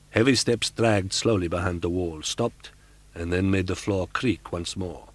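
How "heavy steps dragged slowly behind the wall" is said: usual speaking voice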